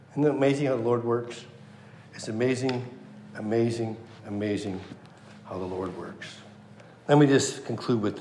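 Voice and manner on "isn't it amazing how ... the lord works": soft, low marvelling; hushed wonder. Real, not acted. Preacher marvelling at how the Lord works, hushed wonder. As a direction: let it sink quieter with each repeat.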